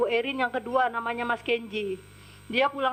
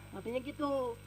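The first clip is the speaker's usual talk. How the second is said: normal talking voice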